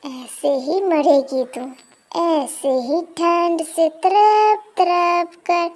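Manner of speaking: slow, eerie witch taunt; sinister ghost voice. Acted. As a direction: drag the curse out slowly.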